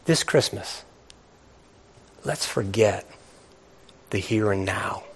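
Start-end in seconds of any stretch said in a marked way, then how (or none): none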